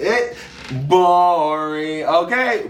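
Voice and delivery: high, drawn-out whine of boredom; exasperated high squeal. Acted. To the camera about a dull season, high exasperated whine. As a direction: squeal it like you cannot stand another minute. Emphatic high boring rant.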